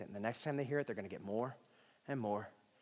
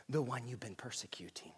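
whisper; 8 dB quieter than usual. Acted. Whispered Jesus line.

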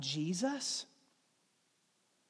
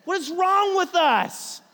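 mock scolding; 7 semitones higher, 5 dB louder, slower than usual. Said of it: "And I'm like".

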